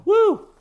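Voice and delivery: very high, loud whoop; spicy whoop. Shown as a demonstration. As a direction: yelp from the heat. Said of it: "a little sweat going".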